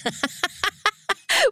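high, rippling giggle; amused laugh. Real, not acted. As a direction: burst into real high-pitched giggles. Amused by son's claim about camp.

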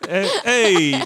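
loud New York "Hey, hey"; New York accent. Pretend. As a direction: call out like a New Yorker. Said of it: "most New York I've ever sounded".

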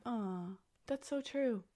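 mock tender. Mock sentimental pity.

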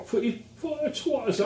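high-pitched mock Cockney; Cockney accent. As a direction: put on a Danny Dyer-style Cockney. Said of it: "adopted a Cockney accent".

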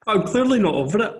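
high, loud through laughter; laughing. Real, not acted. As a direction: laugh it out, mock-wounded. Laughing self-mockery.

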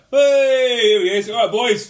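high, loud hail to the lads; hearty mock greeting. Imitating someone rounding the corner to greet the boys, hearty shout. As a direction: call out a big matey hello.

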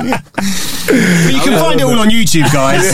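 mock presenter plug.

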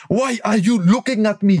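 put-on slow, high, loud Iranian-accented English; caricatured Iranian accent, outraged. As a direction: mimic an angry Iranian customer in English. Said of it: "Ça, c'est l'accent, euh, iranien".

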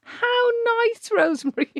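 high sarcastic sing-song. Real, not acted. Mocking the teasing reader.

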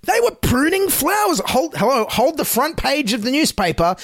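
high-pitched, hammed-up astonishment; mock amazement. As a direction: react as if to a huge scoop, sarcastically. Mocks trivial news as a sensation.

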